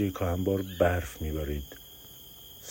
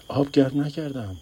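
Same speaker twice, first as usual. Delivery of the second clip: alarmed cry; 10 semitones higher, 7 dB louder than usual. Acted. زن در حال زایمان، هراسان.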